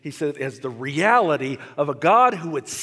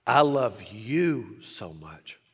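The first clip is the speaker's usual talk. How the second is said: tender voice of God; 7 semitones lower, slower than usual. Acted. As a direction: speak gently as a loving father. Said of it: "God spoke to him and said".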